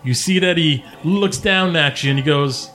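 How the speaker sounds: normal talking voice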